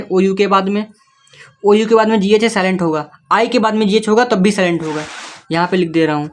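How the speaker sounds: normal talking voice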